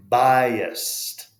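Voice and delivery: "Biased" bridged with a y glide, loud; Y bridge. Shown as a demonstration. Teacher demonstrating a word to students. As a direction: glide "biased" through a y sound. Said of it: "So we make, like, that Y bridge".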